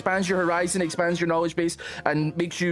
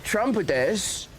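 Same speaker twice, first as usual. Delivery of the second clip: put-on slow, halting Japanese in a British accent, higher pitched; British Trump; 6 semitones higher, much slower than usual. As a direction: struggle through Japanese greetings with drawn-out British vowels. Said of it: "British Trump trying to speak Japanese".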